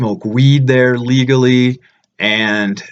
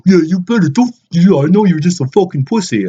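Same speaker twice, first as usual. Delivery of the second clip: mock yell, higher and louder; imitating aggressive guy; 6 semitones higher, 4 dB louder than usual. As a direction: play the rude train guy with hammed-up aggression. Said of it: "he's like".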